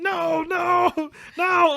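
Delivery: high, loud cries of protest; screaming. Retold or imitated. Soldiers protecting their princess, mock screaming. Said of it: "they just start screaming".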